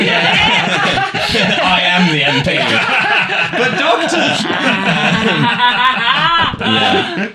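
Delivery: laughed out between giggles; slow, giggling punchline; laughing. Retold or imitated. Telling the podcast about the posh lady, laughing. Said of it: "She called her local M P".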